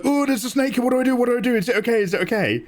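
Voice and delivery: high, panicked yelp, imitating a friend; mock panic, rushing the words. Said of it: "and he's like".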